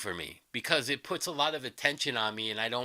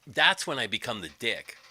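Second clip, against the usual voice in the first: ordinary talk, nothing marked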